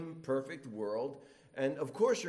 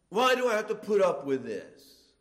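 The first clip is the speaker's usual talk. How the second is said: louder, higher fed-up whine; exasperated complaint; 5 semitones higher, 9 dB louder than usual. Retold or imitated. A grumbler complaining every day, louder and higher. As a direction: sound put upon. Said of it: "You hear these kind of things".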